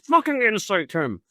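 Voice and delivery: polite request bursting into a high yell; exasperated order. Retold or imitated. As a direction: act out losing patience on the command. Exasperated order to companion, retold.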